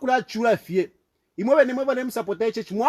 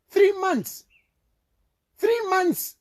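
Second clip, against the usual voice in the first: outraged exclaim; 9 semitones higher, much slower than usual. Real, not acted. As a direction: pitch jumps up high on Three months. Outraged repetition of grievance.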